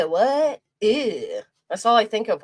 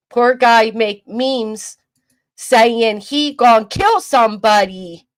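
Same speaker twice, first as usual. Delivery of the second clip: slow, loud, dumb caveman talk; caveman mock voice; 5 dB louder, slower than usual. Mocking a man on stream in slow loud caveman talk. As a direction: stomp through it like an angry caveman.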